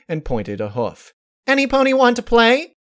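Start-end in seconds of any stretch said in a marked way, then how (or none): 1.5-2.8 high, loud eager call; bouncy high invitation; excited call. Acted. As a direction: call everyone over with glee. Pinkie calls out to group.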